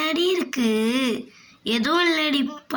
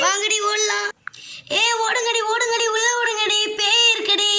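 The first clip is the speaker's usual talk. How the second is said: frightened shouting; 6 semitones higher than usual. Acted. Girl warning friends about the ghost, high frightened shouting. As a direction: scream that there is a ghost while fleeing. Girls flee after seeing ghost.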